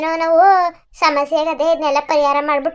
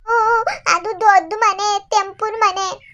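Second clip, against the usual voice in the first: put-on lost little girl; 7 semitones higher, slower than usual. Lost girl answering the man who found her, high childlike voice.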